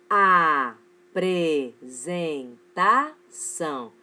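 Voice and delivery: "lapiseira", "matemática", "felicidade" very slow and loud, every syllable separate. Shown as a demonstration. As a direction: chant out each syllable of "apresentação" with a gap between. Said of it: "as palavras têm quatro ou mais sílabas".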